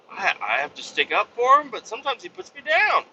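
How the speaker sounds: high-pitched imitation of a coworker; imitated complaint. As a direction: voice climbing sharply as you mimic the complaint. Imitating coworker complaining about brother.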